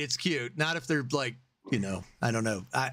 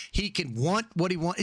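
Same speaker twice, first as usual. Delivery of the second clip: usual speaking voice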